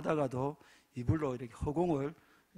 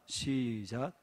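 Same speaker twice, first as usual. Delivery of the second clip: usual speaking voice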